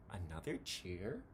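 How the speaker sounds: soft, low surprised question; Tacky surprised by the crowd, soft question; shy surprise. Acted. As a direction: ask it shyly in wonder.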